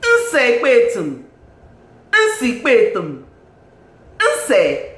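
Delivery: loud, high, drawn-out name call; big emphatic roll-call, falling at each end; calling out her name. Real, not acted. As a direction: proclaim the name slowly in admiration. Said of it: "I'm going to call her name three times".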